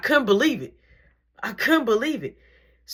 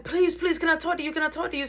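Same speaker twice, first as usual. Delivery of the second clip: high-pitched urgent begging; pleading; 10 semitones higher, faster than usual. Retold or imitated. Student following assistant to reach principal, high pleading. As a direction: beg insistently, pitched well up.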